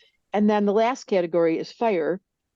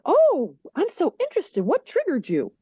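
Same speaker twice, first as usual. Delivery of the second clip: much higher, gushing fake interest; mock fascination; 8 semitones higher than usual. Air person feigning fascination at the upset, high and gushing. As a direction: play the nosy, over-curious listener. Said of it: "And air's like".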